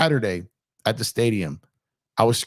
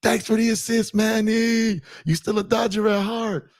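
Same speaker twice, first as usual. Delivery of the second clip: mock heckle; 11 semitones higher than usual. Heckling Manny at the stadium.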